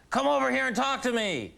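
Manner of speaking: high, insistent holler; imitated yelling. Homeless man summons comedian from across the street, mock yell. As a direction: mimic a stranger calling someone over. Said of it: "this homeless guy across the street sitting on a park bench yells".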